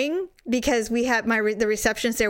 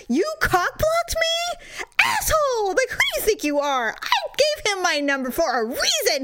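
retold outrage; 12 semitones higher than usual. Telling off the man who blocked her date, mock furious. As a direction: re-enact an indignant, high-pitched tirade. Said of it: "never been so angry".

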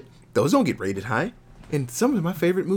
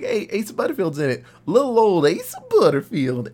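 fast and higher, a doting coo that rises to a louder gush on his name; doting podcast host; 7 semitones higher, 5 dB louder than usual. Retold or imitated. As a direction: gush fondly over little Asa. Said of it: "they were like".

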